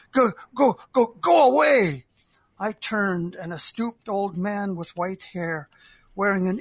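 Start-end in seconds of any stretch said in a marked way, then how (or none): 0.1-2.1 loud, higher angry shout at a trespasser; angry cry. Acted. Old man shouting angrily at an intruder on his field. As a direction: yell him off the field, furious. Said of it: "he said again".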